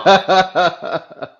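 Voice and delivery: loud, high-pitched burst of laughter; hearty laugh. Real, not acted. Host reacting to guest's marriage remark, hearty laugh.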